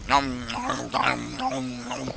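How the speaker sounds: chewing noises with nom nom; munch sounds close to the mic; playful nom nom. Pretend. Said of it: "sounds of me eating".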